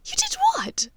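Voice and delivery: high whispered astonishment; amused disbelief. Acted. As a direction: gasp it out, half laughing. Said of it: "asked in amused disbelief".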